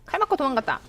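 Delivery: ordinary talk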